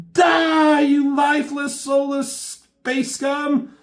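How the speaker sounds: high, loud screaming war cry; battle cry. Acted. Deathbird shooting the fake X-Men, high screaming battle cry. Deathbird opens fire on them.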